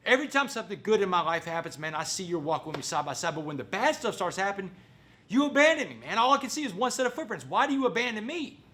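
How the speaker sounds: high, rising accusation, acted out; calling God out. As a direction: challenge God as if deserted by a friend. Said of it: "so he calls God out".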